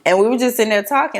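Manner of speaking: ordinary talk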